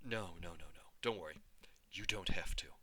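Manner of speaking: soft, hushed comfort fading to a whisper; gentle reassurance. A narrator's reading. Mother comforting her upset daughter, soft and hushed. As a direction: soothe the child softly. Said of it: "looking into my face".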